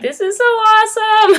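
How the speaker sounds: high, gushing burst of delight; excited squeal. Retold or imitated. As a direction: squeal it like a thrilled nerd. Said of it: "anthropology person in me was like".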